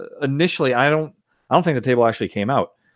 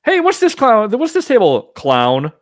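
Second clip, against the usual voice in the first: mock designer voice; 11 semitones higher, 5 dB louder than usual. Mocking the pinball designers' naming.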